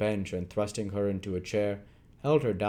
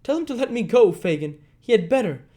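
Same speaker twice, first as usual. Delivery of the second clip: loud, much higher, stamping demand; furious cry; 13 semitones higher, 8 dB louder, faster than usual. Acted. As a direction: cry out and stamp your foot. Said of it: "cried Nancy, stamping her foot".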